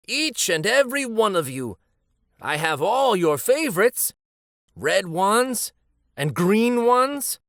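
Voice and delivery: put-on talking to the children. Addressing imagined children.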